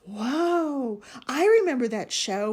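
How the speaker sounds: excited. A narrator's reading. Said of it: "whirled Max".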